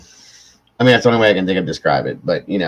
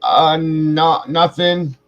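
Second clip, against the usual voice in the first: higher, timid little answer; a kid politely covering up, pitched up; young self; 8 semitones higher, much slower than usual. Retold or imitated. Boy hiding his disgust from his friend's dad, higher sheepish voice.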